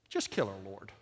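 an under-the-breath plea, played for laughs; muttered inner prayer. Pastor silently praying about a grumbling churchgoer, muttered aside. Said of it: "I remember just thinking at that time".

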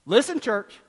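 usual speaking voice

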